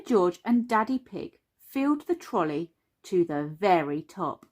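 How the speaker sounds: plain reading voice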